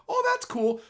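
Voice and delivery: very high, hollow mock politeness; fake polite. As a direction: pretend to like it, high and thin. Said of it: "they're going".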